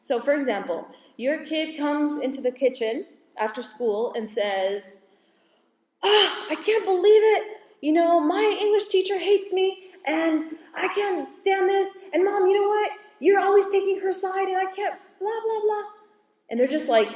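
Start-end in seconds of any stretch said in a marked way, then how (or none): 6.0-15.8 put-on much higher whiny complaining teen; venting angry kid. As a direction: whine and rant like a wronged teenager. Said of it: "venting and angry".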